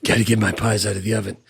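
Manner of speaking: low, fast, put-on guard voice; mock guard voice. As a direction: rattle it off like a dopey guard. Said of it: "says something".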